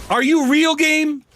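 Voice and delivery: high, indignant challenge; incredulous gamer anger; mad at the game. Real, not acted. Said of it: "I am so mad right now".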